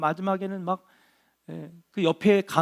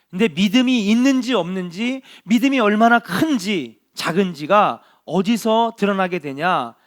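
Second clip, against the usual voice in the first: preaching at full force; 3 semitones higher, 9 dB louder than usual. Acted. Pastor urging the congregation on, preaching loudly. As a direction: preach it out to the whole hall.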